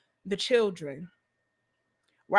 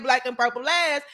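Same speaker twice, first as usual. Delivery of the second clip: ordinary talk, nothing marked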